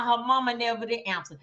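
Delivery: normal talking voice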